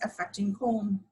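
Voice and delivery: ordinary talk, nothing marked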